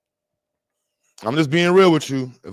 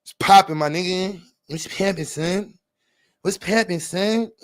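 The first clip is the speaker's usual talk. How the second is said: slightly raised, put-on YN voice; 4 semitones higher than usual. Streamer doing a requested voice for listeners, YN voice. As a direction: do a YN street greeting voice. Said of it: "Do a Y N voice".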